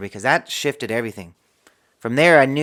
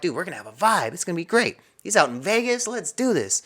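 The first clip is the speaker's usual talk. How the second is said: climbing, high-pitched buddy enthusiasm; retold eager hype; 7 semitones higher than usual. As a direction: hype up a friend, pitch rising as you go. Retells eager plan to hang out.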